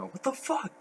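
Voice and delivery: high-pitched imitation of a scream at no great volume; mock scream. Podcaster retelling a friend's panic after a sideswipe, mock screaming. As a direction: squeal it like someone retelling a panic. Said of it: "was just screaming".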